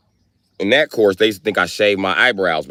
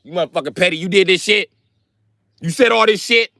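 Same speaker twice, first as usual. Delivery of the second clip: high, loud mock accusation; hammed-up father confronting Muhammad; God accusing; 9 semitones higher, 3 dB louder than usual. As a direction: call him out like an angry dad. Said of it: "Let me go talk to your brother Muhammad".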